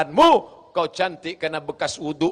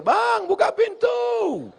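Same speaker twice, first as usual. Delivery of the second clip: high drawn-out call at the door; slow, high, loud call to open up; a wife calling through the door; 3 dB louder, much slower than usual. Retold or imitated.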